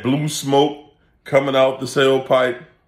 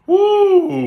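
high loud whoop; real whoop of amusement; exclamation; 6 dB louder than usual. Real, not acted. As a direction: let out a big laughing woo. Laughing at own joke.

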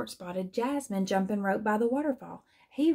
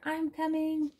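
calling out; 7 semitones higher than usual. A narrator's reading. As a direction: hold the words up high as if calling ahead. Hippo calls while rushing to help.

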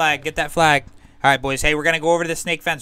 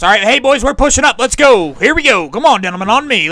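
louder, higher call to advance; rallying shout; 6 semitones higher, 7 dB louder than usual. Acted. Officer leading his troops forward, rallying shout. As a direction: rouse the men to push up.

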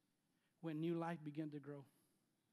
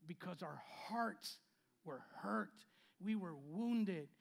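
ordinary delivery

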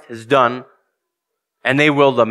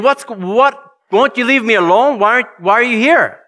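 high, pleading what-are-you-doing-here; exasperated coworker; 8 semitones higher than usual. Retold or imitated. Coworker cornered by the preacher, exasperated high voice. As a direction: raise the pitch in fed-up disbelief.

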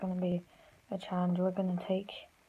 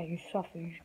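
ordinary talk, nothing marked

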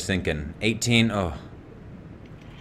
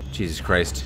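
normal talking voice

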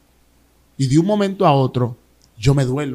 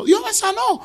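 overjoyed shout; slower than usual. Retold or imitated. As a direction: shout it out full of joy to the servant.

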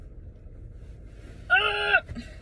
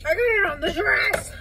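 dismayed wail; 11 semitones higher, 5 dB louder than usual. Real, not acted. Dismayed cry over stain.

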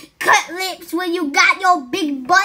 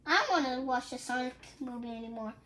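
quiet whine; 6 semitones lower, 16 dB quieter, faster than usual. Real, not acted. Kid quietly complaining to mommy.

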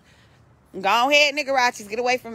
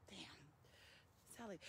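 whispered aside; 6 semitones lower, 38 dB quieter, much slower than usual. Real, not acted. Muttering aside while chasing.

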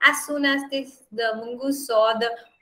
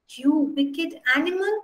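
hysterical scream; 7 semitones higher than usual. Acted. Wife accuses the mongoose of killing her baby, a mild hysterical cry rising high. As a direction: accuse the pet in a frantic rush. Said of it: "She screamed hysterically".

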